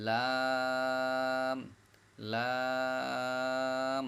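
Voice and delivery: লাম with the vowel held long on one level note; long, lips closing. Shown as a demonstration. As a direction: draw out লাম and close both lips on a hummed m. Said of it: "লম্বাও হবে".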